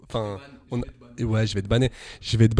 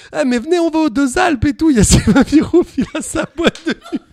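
imitating Alpha's pitch; 9 semitones higher than usual. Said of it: "Alpha a commencé à me dire".

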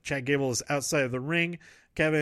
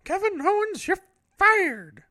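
much higher, squeaky silly boss voice; Cash Levy silly voice; 4 dB louder than usual. Retold or imitated. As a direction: ham up a cartoon boss firing an employee. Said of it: "the Cash Levy, silly voice".

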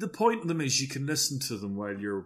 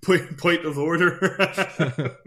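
laughing protest; 6 semitones higher, 5 dB louder, much slower than usual. Real, not acted.

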